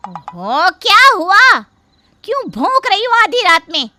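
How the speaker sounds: loud, high, contemptuous shout; rude yell. Acted. Sister-in-law yelling back at midnight, loud and rude. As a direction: bark back at her.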